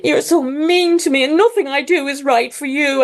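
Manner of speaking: high, lightly whining wounded mother; mock victim whine. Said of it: "go straight to victimhood".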